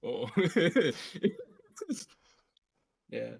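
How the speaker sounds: ordinary talk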